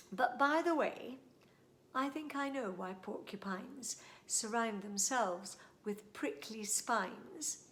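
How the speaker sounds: put-on lively, singsong reading of a rhyme for kids; storytime poem reading. A narrator's reading. Host reads a funny poem to young listeners, lively and singsong. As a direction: read it out like a bedtime poem to children.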